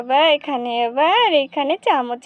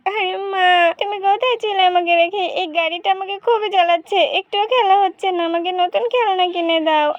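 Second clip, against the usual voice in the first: put-on higher, pleading child; little girl whining to her mother; whiny little girl; 4 semitones higher than usual. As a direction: nag your mother for a new toy.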